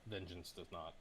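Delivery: ordinary talk, nothing marked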